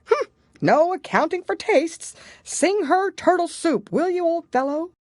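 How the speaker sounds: high, loud, huffy creature voice; a creature in a huff, high and loud; offended Gryphon. A narrator's reading. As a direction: sniff it out, put out and haughty. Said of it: "in a rather offended tone".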